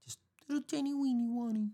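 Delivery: very high, thin squeak; tiny squeaky voice. Pretend. Imagining a squirrel heart, very high squeak. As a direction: shrink your voice to a squirrel's size. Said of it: "how tiny a squirrel heart would be".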